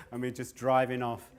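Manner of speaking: ordinary talk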